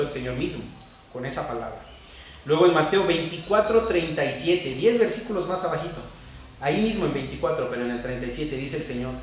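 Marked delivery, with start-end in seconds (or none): none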